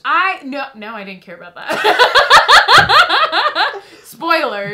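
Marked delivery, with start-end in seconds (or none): 1.8-4.0 long, high burst of real laughter; loud cackling laugh. Real, not acted. Co-host cracks up over a spoiler, loud cackling laugh. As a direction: crack up loudly, high and rolling.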